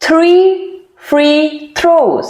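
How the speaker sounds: "he threw" "three" "free throws" split with pauses, "three" lifted high; slowly, word by word. Shown as a demonstration. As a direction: ease through each word before picking up speed. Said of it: "तो धीरे धीरे".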